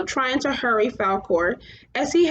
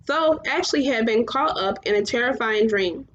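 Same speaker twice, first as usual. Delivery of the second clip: plain reading voice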